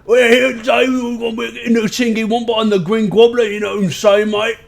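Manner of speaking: loud, high, hammed-up British accent; over-the-top British lad, shouting high; mock British accent. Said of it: "been working on, um, I'm learning the, the King's English".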